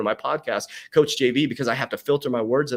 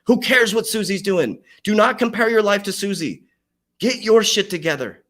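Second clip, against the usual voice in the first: loud rant; 6 semitones higher, 5 dB louder than usual. Real, not acted. Coach ranting at his viewers about comparing to Susie, loud and high.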